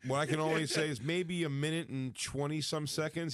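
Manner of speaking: usual speaking voice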